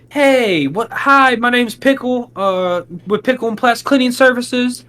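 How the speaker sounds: high, put-on salesman greeting; a mocking, fake-friendly sales voice; fake customer voice. Shown as a demonstration. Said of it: "make me feel so fake if I'm sitting here like".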